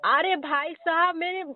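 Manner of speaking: ordinary delivery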